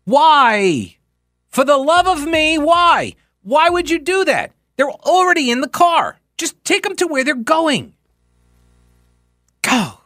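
exasperated plea. Real, not acted. Host begging planners to just drive riders home, exasperated. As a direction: beg them to see sense.

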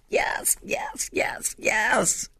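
voiceless, fading whisper of yes; whispered yeses. Real, not acted. After the shout, the preacher whispers yes to the congregation. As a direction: let the yeses fall to a hush. Preacher echoes her answer.

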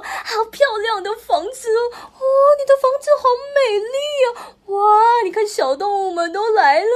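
high, loud gushing awe; envious admiration. A narrator's reading. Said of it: "都羡慕地说".

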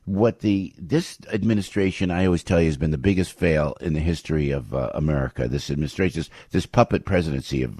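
ordinary talk, nothing marked

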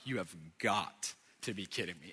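low, hushed mock disbelief; stunned disbelief. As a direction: retell it very low and soft, barely believing. Retold stunned reaction to the tree.

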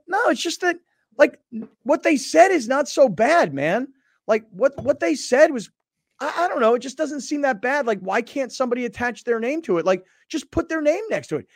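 high-pitched retold pleading; protesting. Host pressing guest about unnamed sources, high-pitched protest.

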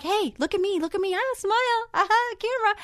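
bubbly photographer calling for a smile; cheery photographer. Retold or imitated. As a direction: lure a scared client into smiling.

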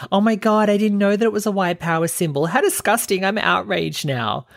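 high-pitched put-on shock; mock outrage. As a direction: imitate scandalised online commenters, pitched well up. Mimics outraged responses on Twitter.

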